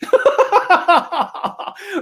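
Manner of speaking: long, high-pitched cackle; high laugh at your own jab; teasing laugh. Acted. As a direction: burst into high, mischievous laughter. Exorcist laughing as he pokes demon.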